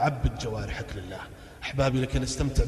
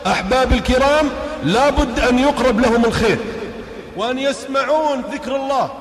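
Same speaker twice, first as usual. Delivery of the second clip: loud, high fervent preaching; raised preaching voice; 9 semitones higher, 7 dB louder than usual. Real, not acted. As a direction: rouse the crowd at full force. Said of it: "وأرفع صوتي".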